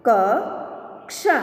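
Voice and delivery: slow, each sound drawn out, rising at the end; slow drawn-out reading. Shown as a demonstration. Teacher slowly sounding out a word for students, drawn out. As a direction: stretch the word slowly for the class. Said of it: "अब इसका शब्द हम देखें".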